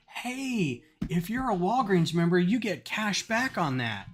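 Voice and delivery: high, bubbly sales pitch, slowing at the end; enthused cashier pitch. Retold or imitated. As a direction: sell the membership with big excitement. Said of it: "he was really enthused".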